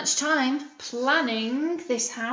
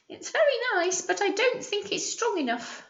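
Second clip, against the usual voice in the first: high, doubtful piglet voice; doubtful little pig; 9 semitones higher than usual. Acted. Teacher voices the third pig doubting the stick house, high.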